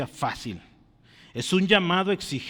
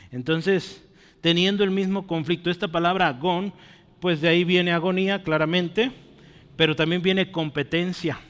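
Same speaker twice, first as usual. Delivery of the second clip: normal talking voice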